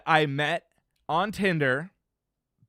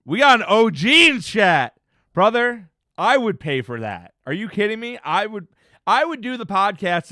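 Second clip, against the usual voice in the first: excited; 8 semitones higher, 8 dB louder than usual. Real, not acted. Excited reaction to a chat message.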